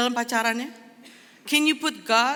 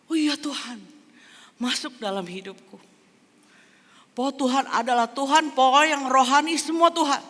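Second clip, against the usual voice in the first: eager believer; 8 semitones higher, 8 dB louder than usual. Retold or imitated. Imitated eager new believer.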